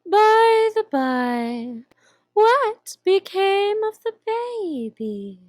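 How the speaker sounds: slow, purring Cheshire Cat voice; slow drawling cat. Acted. Grinning cat teasing the lost girl, slow and drawn out. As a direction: stretch each word like a lazy cat.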